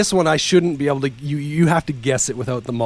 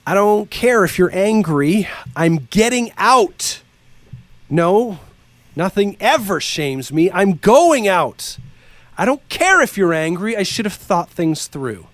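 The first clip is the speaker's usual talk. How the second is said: dramatic reading; 3 semitones higher than usual. Acted. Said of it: "dramatic read".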